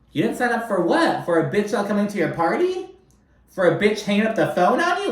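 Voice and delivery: mock her excuse in disbelief; incredulous. Real, not acted. Ranting at Shannon's complaint.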